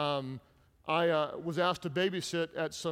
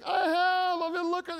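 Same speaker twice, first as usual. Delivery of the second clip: put-on high, loud, anguished kid confessing; retelling himself as a boy confessing to mom; guilty little boy; 14 semitones higher, 6 dB louder than usual. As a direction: blurt it out like a guilt-ridden young boy. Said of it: "I just like".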